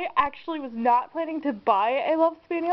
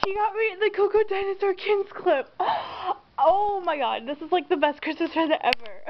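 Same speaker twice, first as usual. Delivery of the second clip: high, gushing amazement between gasps; overjoyed, pitch lifted in delighted disbelief; real excitement; 6 semitones higher than usual. Real, not acted. Gift opener getting a long-wanted clip, excited. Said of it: "You have no idea how excited I am right now".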